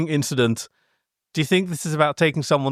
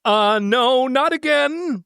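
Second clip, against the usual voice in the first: high, louder whiny groan; bemoaning; 10 semitones higher, 3 dB louder, slower than usual. Acted. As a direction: whine it in dismay. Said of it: "Brad bemoaned through chattering teeth".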